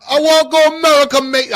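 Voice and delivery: very high, loud, hammed-up British yelling; bad British accent. Begging a mate to go to America, loud fake British. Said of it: "I don't know how to do it".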